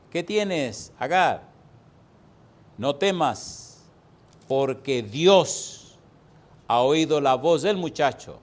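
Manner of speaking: loud and slow, word by word; solemn booming Bible reading; forceful scripture reading. Acted. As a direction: proclaim the verse from the pulpit.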